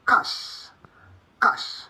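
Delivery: cash with soft "sh" ending; correct cache. Shown as a demonstration. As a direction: say cash as one syllable, silent E, hushed "sh". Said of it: "the C H is pronounced as".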